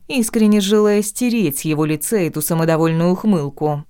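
ordinary delivery

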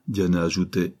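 ordinary delivery

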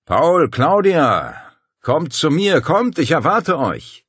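langgezogener, hoher, lauter Lockruf; dumpfe Geisterstimme. A narrator's reading. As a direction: wie ein Geist aus der Finsternis rufen. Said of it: "aus der Finsternis eine dumpfe Stimme erscholl". (unheimlich rufende Stimme aus dem Dunkel)

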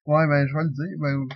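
normal talking voice

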